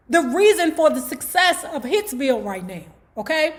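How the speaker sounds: put-on a mother chewing out her boy; Mother Gordy scolding. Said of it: "she like saying to herself".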